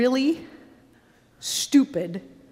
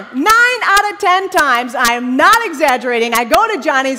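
exasperated mock rant; 3 semitones higher, 8 dB louder than usual. Preacher griping about Johnny's running out, loud exasperation. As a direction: stretch the opening words into a shout.

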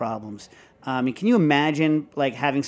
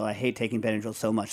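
ordinary talk, nothing marked

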